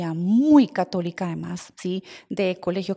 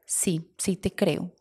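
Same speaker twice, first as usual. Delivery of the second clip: slow, soft, tender yes; tender admission; 3 semitones lower, 8 dB quieter, much slower than usual. Retold or imitated. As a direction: give in gently and slowly. La madre por fin le cree. (la madre admite, suave y lenta: con ternura)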